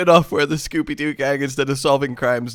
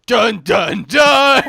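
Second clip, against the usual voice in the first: sung-out "dun dun dun", climbing louder and higher; dramatic sting; 6 semitones higher, 7 dB louder, much slower than usual. Acted. Capping the dark Scooby-Doo joke, mock-dramatic sting. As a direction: belt a mock suspense sting, building.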